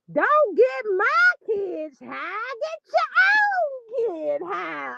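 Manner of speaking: high, drawn-out mock wail; imitating her voice. Host mocking how a woman on a live sounds, exaggerated high wail. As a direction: stretch it into a mocking high whine. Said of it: "Why she sound like that?".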